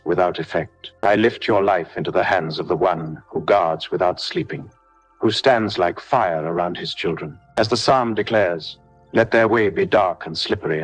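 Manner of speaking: usual speaking voice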